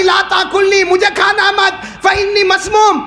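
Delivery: high, loud urgent call; loud warning cry. Acted. Poisoned morsel warns the Prophet, loud cry. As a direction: cry out a warning so all hear. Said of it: "آواز بار بار آ رہی".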